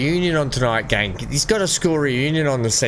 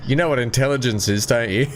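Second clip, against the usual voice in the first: laughing through the comeback. Retold or imitated. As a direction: retort with a laugh breaking in at the end. Retelling his comeback, laughing.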